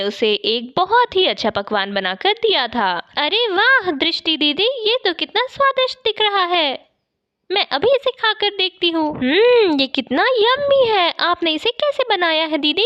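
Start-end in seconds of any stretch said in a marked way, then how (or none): 3.2-12.8 put-on high, thrilled little girl voice; delighted child. Young girl praises the maid's cooking, high and thrilled. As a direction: gush over the food like an excited kid.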